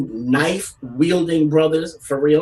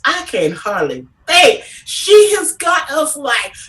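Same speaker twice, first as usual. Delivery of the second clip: excited gushing; 10 semitones higher, 3 dB louder than usual. Real, not acted. Gushing about [name] and [name].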